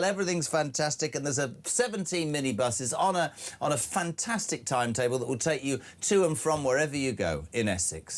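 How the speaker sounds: high-pitched, hammed-up rave about the camp; gushing reviewer. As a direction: oversell it like a giddy holiday advert. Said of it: "and I quote".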